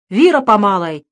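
громкая отрывистая команда; скомандуй на всю округу; команда крановщику. Acted. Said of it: "подал команду". (громко командует подъём)

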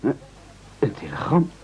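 startled whispered question; surprised whisper. Acted. A telegram for him at sea, whispered in surprise. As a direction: breathe it out in stunned disbelief.

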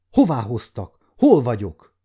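alarmed. A narrator's reading. Captive girl demanding to know where she is, alarmed. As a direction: ask in rising alarm.

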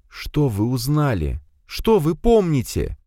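slow, distinct questions; clear and slow. A narrator's reading. Evelyn slowly questioning the disembodied head. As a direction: spell each word out slowly. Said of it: "чётко и медленно сказала женщина".

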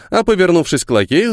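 ordinary delivery

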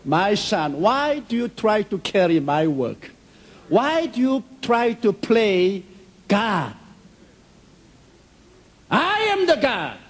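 rising into a high, solemn divine voice; building from plain into lofty God. Retold or imitated. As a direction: speak as God answering his son. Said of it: "God came to me and revealed".

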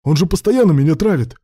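high, loud complaint; desperate protest. A narrator's reading. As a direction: wail out your defence loudly. Desperate self-defence in quarrel.

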